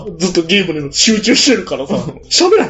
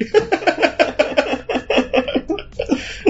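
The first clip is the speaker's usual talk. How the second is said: long high laugh; 9 semitones higher, 5 dB quieter than usual. Real, not acted. Laughing at the pushups.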